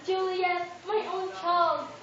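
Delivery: slow, loud, rising lament; grieving wail. Acted. Mother wailing over Juliet's body, slow and loud. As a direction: wail over your dead child. Mother mourns dead daughter.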